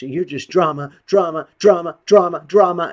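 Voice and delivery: high, loud shrill taunt repeating "drama"; mocking imitation. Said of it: "she just".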